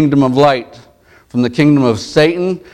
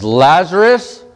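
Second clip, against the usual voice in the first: slow, higher call of the name; loud call; 5 semitones higher, slower than usual. Acted. Jesus calling Lazarus from the tomb, loud and drawn out. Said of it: "with a loud voice says".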